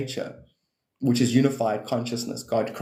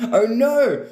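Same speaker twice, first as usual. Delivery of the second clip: high, louder mock alarm; half-playful dismay, higher and louder; 10 semitones higher, 6 dB louder than usual. Real, not acted. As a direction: yelp it like you caught yourself. Alarmed by own laugh.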